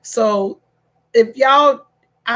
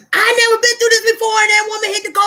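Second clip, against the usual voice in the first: higher, louder, fast wailing; whiny husband; 10 semitones higher, 5 dB louder, much faster than usual. Retold or imitated. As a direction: wail like the upset husband. Husband's wail voiced.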